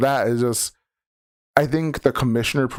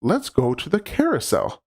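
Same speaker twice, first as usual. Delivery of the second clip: mock cheerful; 6 semitones higher than usual.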